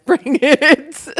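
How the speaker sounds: high, very loud laughing whoop; gleeful shout. Real, not acted. Streamer egging on raiders, gleeful high shout. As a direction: dare them at full voice, laughing.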